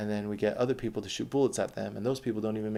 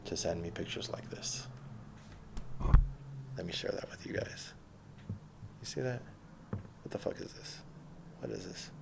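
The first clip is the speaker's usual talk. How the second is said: unvoiced hushed whisper, much softer; 3 semitones lower, 5 dB quieter than usual. Real, not acted. Vlogger whispering over a girl's pictures. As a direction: lean in and whisper it conspiratorially.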